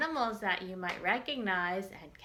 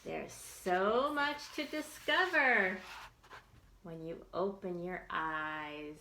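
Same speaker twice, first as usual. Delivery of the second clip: put-on reading to children; 3 semitones higher than usual. Teacher reads picture book to children.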